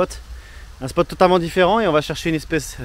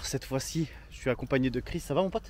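hushed, much softer voice; library whisper; 2 semitones lower, 11 dB quieter than usual. Real, not acted. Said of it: "pourquoi on parle tout bas".

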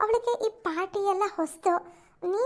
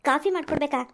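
lower, mocking disbelief at the request; scornful scoff; 7 semitones lower than usual. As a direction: sneer back at him. [name] scoffs at coffee request. (ಲೋಟ coffee ಕೇಳಿದ್ದಕ್ಕೆ ತಿರಸ್ಕಾರದ ಕುಹಕ)

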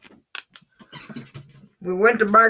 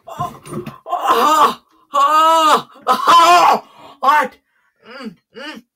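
pained cries; 10 semitones higher, 6 dB louder, much slower than usual. Real, not acted. Pepper burning his mouth.

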